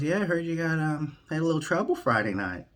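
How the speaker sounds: high, lightly put-on Biden voice; Biden impression. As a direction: play Biden casually raising it. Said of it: "Then Biden goes".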